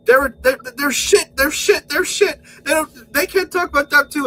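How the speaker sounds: high, loud mocking whine of Joe; mocking whiny impression. Mocking Joe's whining about Doctor Who, high and loud. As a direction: squeal it out like a sulking kid. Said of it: "you're like".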